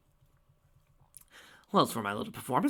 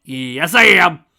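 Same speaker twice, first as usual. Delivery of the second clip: much louder, gleeful crazed yell; manic shout; 6 semitones higher, 18 dB louder, much slower than usual. Acted. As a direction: proudly scream that you're crazy. Villain admits being crazy.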